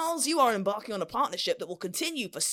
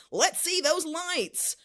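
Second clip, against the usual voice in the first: drawn out slow and high; gameshow reveal; 6 semitones higher, much slower than usual. Acted. Host calling for the buzzer lights, slow and high. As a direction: stretch it like a big TV reveal.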